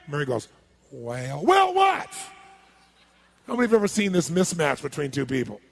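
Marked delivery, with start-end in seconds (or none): 0.9-1.4 low, soft, drawn-out reply; slow talker. Acted. Mary starting to answer the fast talker, slowly. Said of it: "about a slow-talking person". 1.4-2.1 very high, loud interruption; impatient shout. Acted. Fast talker losing patience with Mary, shouting.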